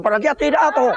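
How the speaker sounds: comic hue and cry; mock chase cry. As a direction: call out like cooks chasing a parrot. Cooks with knives chase the parrot.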